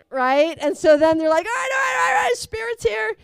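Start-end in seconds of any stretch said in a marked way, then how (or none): 1.4-3.1 ecstatic announcement. Retold or imitated. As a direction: announce the Spirit like a thrilled revival leader. Leaders announce Spirit arrived.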